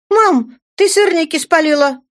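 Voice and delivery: put-on teenage daughter crowing over burnt pancakes; indecently gleeful. A narrator's reading. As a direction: announce the burnt pancakes with glee. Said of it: "получилось неприлично радостно".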